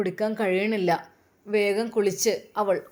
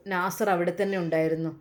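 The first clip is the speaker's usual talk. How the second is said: usual speaking voice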